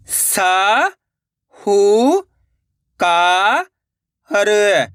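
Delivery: long drawn-out syllables with pauses between. Shown as a demonstration. Teacher sounding out a word for learners, syllable by syllable. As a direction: sound out each syllable slowly and separately. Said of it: "एक एक अक्षर का उच्चारण करके".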